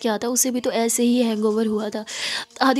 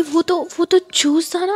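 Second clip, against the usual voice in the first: timid protest; 6 semitones higher, 4 dB louder than usual. Acted. Girl protests timidly it was juice.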